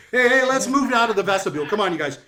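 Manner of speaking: loud, high, quick ushering; brash jokester host. Acted. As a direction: hurry the guests along like a pushy showman. Jokester figure ushers guests along.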